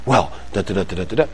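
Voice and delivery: low, soft da-da patter; hushed placeholder babble; mumbled filler answer. Acted. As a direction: mumble a stand-in for the kid's reply. Child's answer mimed with nonsense syllables.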